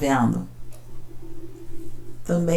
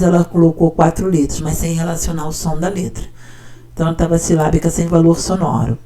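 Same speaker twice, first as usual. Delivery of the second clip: ordinary talk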